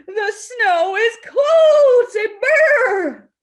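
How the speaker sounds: high, loud, drawn-out exclamation; dramatic shivery reading. A narrator's reading. Reading to children as Kitty feels snow, high and dramatic. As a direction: stretch it out like a shock of cold.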